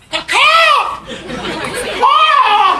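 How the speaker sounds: high shouted caw. Pretend. Feeling high off meds, crowing like a bird.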